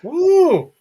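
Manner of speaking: high, loud teasing ooh; mock ooh. Host teases Sean about his fan base with a swooning ooh.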